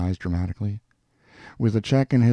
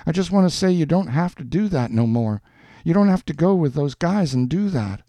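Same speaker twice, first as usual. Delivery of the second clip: raised, insistent man's plea; earnest Schultz; 9 semitones higher, 3 dB louder than usual. A narrator's reading. Man tells woman she needn't go with others, pleading. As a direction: urge it in a higher voice. Voices Schultz urging Jeannie.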